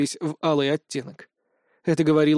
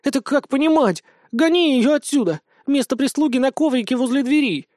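outraged scolding; 9 semitones higher than usual. Acted. Wolf orders the dragon off the bed, outraged. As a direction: chase the rival off with fury.

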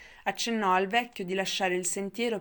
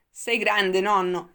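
put-on much louder, delighted boy's exclamation; amused exclamation; 9 dB louder, much slower than usual. A narrator's reading. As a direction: cry it out, tickled by grandpa. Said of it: "esclamò divertito". (esclamazione divertita, forte)

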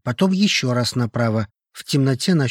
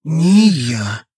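gurgling, wet, louder voice; strange gurgling voice; 3 semitones higher, 6 dB louder than usual. A narrator's reading. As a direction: gurgle it like phlegm in the throat. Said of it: "странным булькающим голосом".

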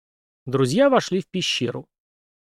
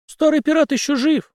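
high, louder burst of surprise; old man's high-pitched astonishment; astonished; 5 dB louder than usual. A narrator's reading. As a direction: exclaim it high, amazed at the news. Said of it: "удивлённо присвистнул старик".

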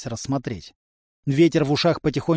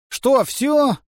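high, plaintive little question; sorrowful, disappointed high voice; sad; 11 semitones higher, 4 dB louder than usual. A narrator's reading. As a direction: ask it mournfully, pitched well up. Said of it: "печально спросил теперь уже Тришка".

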